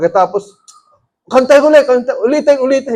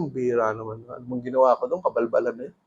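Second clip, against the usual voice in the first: much softer, lower, muttered complaint; grumbling under breath; 10 semitones lower, 12 dB quieter than usual. Retold or imitated. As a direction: grumble to yourself, frustrated. Grumbling to himself after visit. (pabulong na reklamo)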